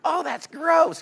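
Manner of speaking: put-on squeamish girl. Girls hearing the David and Goliath story, mock disgusted. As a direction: mimic a grossed-out girl.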